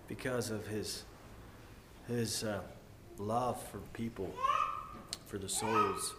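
fighting back tears as he speaks of love; choked up. Real, not acted. Preacher to congregation, on Jesus' love for souls, near tears. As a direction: voice thinning, near tears, with a broken laugh.

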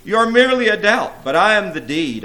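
loud, high-pitched gloating; grinning boast. A narrator's reading. Infidelity outdoes a rival demon, gloating with a grin. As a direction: crow over a lesser rival. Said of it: "Infidelity said with a grin".

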